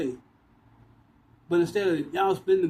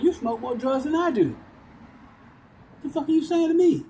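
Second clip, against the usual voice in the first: higher and louder, building into an incredulous yell; retold outburst; 9 semitones higher, 3 dB louder than usual. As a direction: throw the accusation back, getting louder. Retold argument over drugs.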